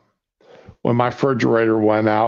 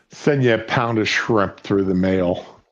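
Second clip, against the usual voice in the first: ordinary talk, nothing marked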